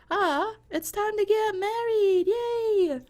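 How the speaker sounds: very high, slow, gleeful cheer; excited bride. Acted. Bride cheering on her wedding day, very high and excited. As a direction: squeal with joy.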